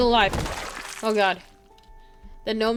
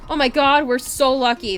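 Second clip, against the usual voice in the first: loud, rattled exclamation; shaken relief; 4 semitones higher, 8 dB louder than usual. Real, not acted. Player just escaped zombies ripping her clothing, rattled and relieved. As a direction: exclaim it just after a close call.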